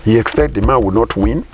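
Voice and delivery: normal talking voice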